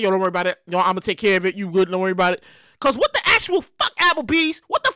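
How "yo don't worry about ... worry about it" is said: lower, laid-back manager voice; smooth it over like a chill manager; imitating the manager. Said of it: "He's like".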